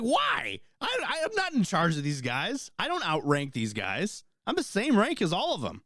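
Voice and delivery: high, exasperated retold protest; indignant protest. To the first sergeant, a high indignant protest.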